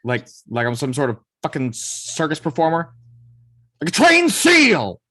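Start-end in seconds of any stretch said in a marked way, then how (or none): 3.8-5.0 slow, high, furious shout; angry shout. Acted. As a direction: bellow it in outrage. Nick outraged at the suggestion.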